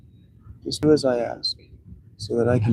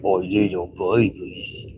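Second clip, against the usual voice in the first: put-on slow cooing baby voice, softer at the end; baby talk; 4 semitones lower, 4 dB quieter, slower than usual. Shown as a demonstration. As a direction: talk down like to an infant. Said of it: "if I just talked like this".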